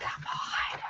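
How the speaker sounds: hushed, whispered exasperation; retold protest under the breath; whispered disbelief. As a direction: whisper it, appalled at the price. Retells own dismay at the price.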